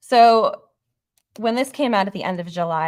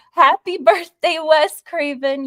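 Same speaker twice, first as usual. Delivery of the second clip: excited birthday wish; 9 semitones higher, 7 dB louder, slower than usual. Real, not acted. Belated birthday shout to Wes Craven.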